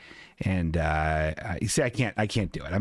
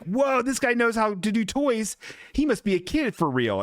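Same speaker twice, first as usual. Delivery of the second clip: awed boss; 9 semitones higher than usual. Retold or imitated. Retelling movie boss in awe.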